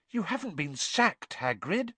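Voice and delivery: high-pitched, dismayed gasp; shocked, pitched well up. A narrator's reading. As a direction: gasp the question in alarm. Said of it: "gasped Hermione".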